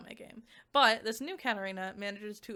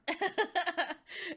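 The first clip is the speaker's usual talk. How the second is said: real laugh; 10 semitones higher than usual. Real, not acted. Laughs at own mouthful.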